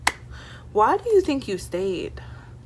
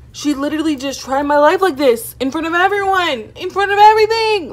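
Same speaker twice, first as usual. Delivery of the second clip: rising outrage, higher and louder; outraged; 8 semitones higher, 8 dB louder than usual. Real, not acted. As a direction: build to a furious peak. Retelling being disrespected, rising outrage.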